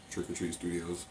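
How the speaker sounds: ordinary talk, nothing marked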